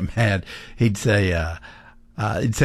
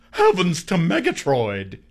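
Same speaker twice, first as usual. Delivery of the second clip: cartoon catchphrase; 7 semitones higher than usual. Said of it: "cartoon character that would say".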